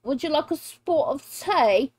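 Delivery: playful mock British accent. Showing off the accent she loves, mock British. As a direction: offer it in a put-on British accent. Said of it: "I love the British accent".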